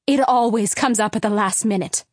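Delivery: loud, bitter mother's retort; mother yelling. Acted. Mother yelling back at husband's excuse. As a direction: fling his words back at him. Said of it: "yelled my mom".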